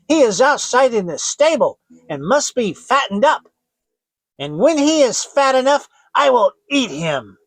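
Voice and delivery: high, loud, harsh witch commands; witch shouting. Acted. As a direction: order the lazy girl about at full voice.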